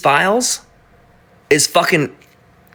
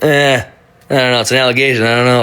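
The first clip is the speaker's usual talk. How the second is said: mock dismissive shrug; 3 semitones lower than usual. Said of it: "start out an investigation with".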